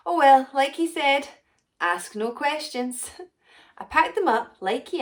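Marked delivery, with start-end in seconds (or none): 0.1-3.1 high and loud, then slow on ask no questions; breezy dismissal. Acted. Potion maker shrugging off Carl's request, loud and breezy. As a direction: toss it off cheerfully, then drawl the motto. Quoting friend's advice.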